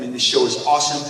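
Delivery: ordinary talk, nothing marked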